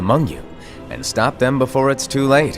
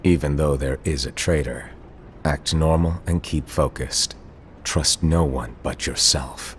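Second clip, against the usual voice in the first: low, measured caution; solemn, deep-pitched counsel; grave warning; 11 semitones lower, 4 dB quieter than usual. Acted. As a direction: drop the voice right down and warn them. Master's solemn warning about the traitor.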